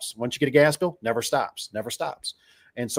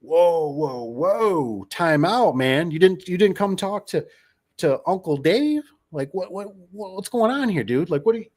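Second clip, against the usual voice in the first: alarmed; 6 semitones higher than usual. Retold or imitated. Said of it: "and I'm like".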